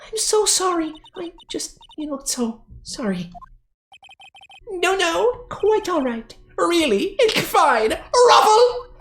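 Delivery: flustered character voice. Acted. Character apologising to the star, flustered and giggly. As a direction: gush at the fan apologetically.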